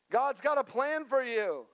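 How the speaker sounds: high, swooping prophetic proclamation; hammed-up church prophecy, pitched high; mock prophet. Prophet handing a churchgoer a vague word, mock prophetic. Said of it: "prophetic moment, and they would say".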